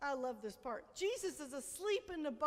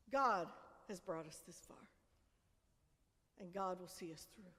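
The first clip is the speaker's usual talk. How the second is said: hushed reverence; 9 semitones lower, 6 dB quieter than usual. Real, not acted. Pastor assuring the congregation, hushed reverence. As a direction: drop right down, much softer and lower.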